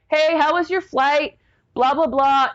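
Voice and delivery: high, casual cheery voice of the brother-in-law; breezy greeting. Retold or imitated. Clueless brother-in-law picks her up, mock cheery hello. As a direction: mimic his carefree hello.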